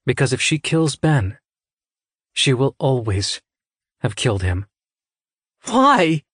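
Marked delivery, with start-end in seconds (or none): 5.6-6.2 high-pitched, distraught demand; anguished question. A narrator's reading. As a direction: cry out for a reason. Citra demands reason for cruel test.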